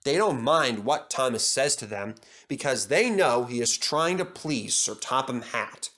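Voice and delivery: plain reading voice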